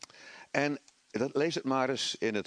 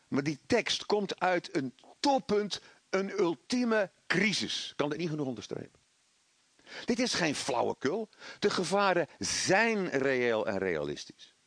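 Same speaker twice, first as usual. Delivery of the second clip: usual speaking voice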